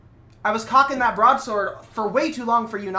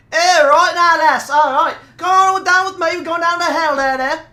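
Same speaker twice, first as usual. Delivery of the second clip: louder hammed-up Cockney demon; Cockney accent; 4 semitones higher, 6 dB louder than usual. Pinhead inviting the lass to hell, in Cockney. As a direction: cheerfully drag her down to hell, Cockney style. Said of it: "Yeah, that was Cockney".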